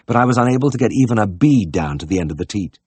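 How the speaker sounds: usual speaking voice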